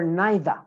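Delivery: British "neither", NYE-thuh; British pronunciation. Shown as a demonstration. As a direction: say "neither" with an eye vowel, no R. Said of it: "in British".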